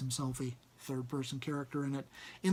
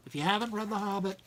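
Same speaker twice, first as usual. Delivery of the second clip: usual speaking voice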